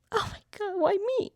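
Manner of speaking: high, breathy wail of self-pity; mock despair. Alone in the corner, wanting to grieve: high mock wail. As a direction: sigh it out like a lonely mourner. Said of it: "you wanna be just in the corner".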